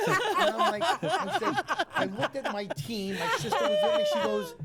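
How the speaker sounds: loud, high laughter through the words; can barely get it out for laughing; laughing hard. Real, not acted. Laughing at the spirit guide surprise.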